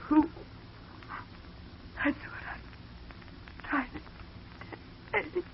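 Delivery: breathless, very slow, fading whisper; dying whisper. Acted. Shot woman telling Eddie her last words, whispered. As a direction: let the last breath carry it.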